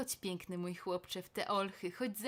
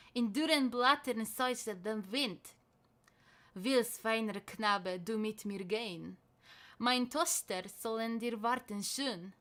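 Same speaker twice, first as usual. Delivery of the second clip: ordinary delivery, nothing marked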